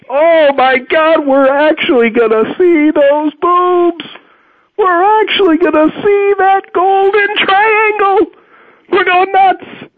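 hammed-up teenage boy drooling; giddy teen excitement. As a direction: gush like a dazzled teenage boy. Retold teen boys' excitement.

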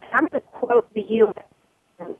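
choppy, dropping phone line; broken-up connection, words dropping out; audio cutting out. Real, not acted. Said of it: "Our audio is cutting, is cutting out".

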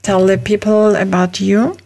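ordinary talk

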